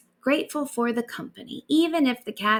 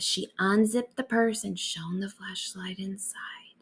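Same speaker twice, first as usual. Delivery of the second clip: ordinary delivery, nothing marked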